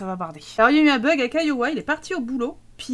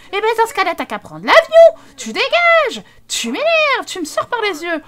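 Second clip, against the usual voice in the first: high, loud furious yelling; wife shouting at her husband to get lost; 8 semitones higher, 7 dB louder than usual. Acted. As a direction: throw him out. Voicing wife in quarrel.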